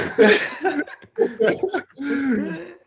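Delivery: loud high burst of laughter; real laugh. Real, not acted. Remembering the crowd chanting MVP, open laugh. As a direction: laugh it out, delighted. Laughs at his own urge.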